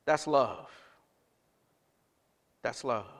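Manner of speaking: quiet sincere conclusion; tender. Acted. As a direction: let it land gently. Repeated softly.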